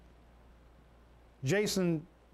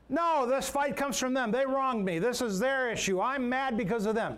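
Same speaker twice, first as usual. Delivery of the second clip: acted indignant protest; 6 semitones higher than usual. Preacher voices a wronged person blaming others, indignant. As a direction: imitate someone hotly blaming others.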